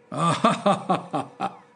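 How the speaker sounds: a cruel hearty laugh at the dwarf; roaring laugh. Acted. The king laughs at the weeping jester, mocking laugh. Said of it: "roared the latter".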